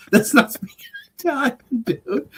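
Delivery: very high comic objection; squeaked-up retold complaint; mock protest. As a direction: imitate a comic's incredulous correction. Retold comedy bit, buddy objects.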